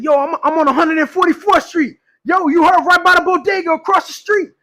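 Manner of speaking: trolling in a put-on New York accent; animated mock New Yorker giving directions. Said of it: "my New York accent".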